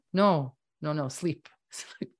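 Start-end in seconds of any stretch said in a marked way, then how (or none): 1.7-2.1 a soft laughing whisper. Real, not acted. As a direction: breathe the word sleep out. Laughing urge to sleep.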